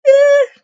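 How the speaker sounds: sudden loud, high squeal; short loud squeal. Real, not acted. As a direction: let out a piercing little "eh!". Squeals after saying she blushes.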